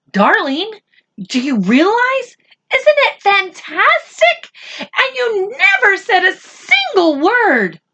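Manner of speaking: overflow with pride; a mother gushing to her son, very high; thrilled mother. Acted. Mother overjoyed at son's prize.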